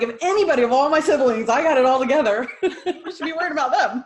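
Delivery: high, loud teasing boast breaking into laughter; joking boast. Retold or imitated. Daughter joking about her siblings to listeners, laughing boast. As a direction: crow it playfully, laughing as you go. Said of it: "just kidding".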